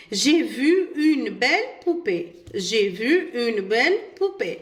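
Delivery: very slow, each French word laid out clearly; slow model reading. Shown as a demonstration. Teacher reads the example sentence to pupils, slowly. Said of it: "La troisième phrase".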